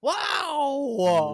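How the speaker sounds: high loud shocked cry. Real, not acted. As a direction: gasp out in disbelief. Shocked by anti-country rant.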